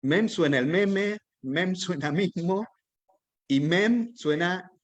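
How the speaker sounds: slow emphatic "mem" repeated; stressing mem. Shown as a demonstration. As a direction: lean on every "mem" slowly, like a teacher driving it home. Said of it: "todo en francés suena mem".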